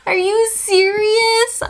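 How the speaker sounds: very high, loud, stretched-out squeal; hammed-up incredulous shriek, slowly drawn out; outraged disbelief. Cleared traveler to the officers, outraged disbelief.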